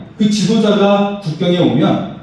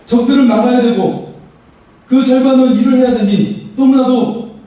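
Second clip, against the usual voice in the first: a touch of worried people pleading, higher; complaining townspeople; 8 semitones higher than usual. Retold or imitated. Preacher voicing Judah's people complaining to him, higher and anxious. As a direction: voice the anxious builders crying out, raised pitch. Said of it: "그렇게 말을 하고 있는 것입니다".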